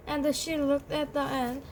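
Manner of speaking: usual speaking voice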